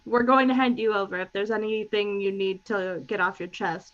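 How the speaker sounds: ordinary talk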